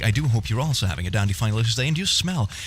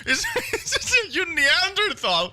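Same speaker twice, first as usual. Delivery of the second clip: high, squeaky laughing exasperation; breaking into giggles, voice pitched way up; cracking up; 12 semitones higher than usual. Real, not acted. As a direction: fight through laughter to get it out. Cracking up at syllable argument.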